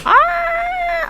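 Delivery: high mock turkey screech; imitated turkey squawk. To chat, imitating the viral turkey video with a squawk. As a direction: squawk like a squashed plastic turkey. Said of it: "I can't even do the impression".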